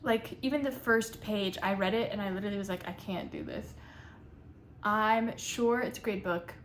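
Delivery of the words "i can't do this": low defeated mutter. Retold or imitated. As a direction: drop low and soft, giving up. Quoting own reaction to book.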